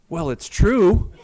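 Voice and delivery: drawn-out, playful protest; mock-defensive comeback. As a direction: stand your ground with comic indignation. Retells his defiant comeback for laughs.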